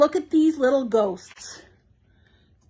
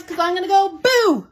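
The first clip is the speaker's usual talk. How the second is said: playful mock boo; 6 semitones higher, 5 dB louder than usual. Playfully scaring viewers.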